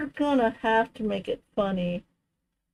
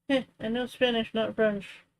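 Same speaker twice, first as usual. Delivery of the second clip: ordinary talk, nothing marked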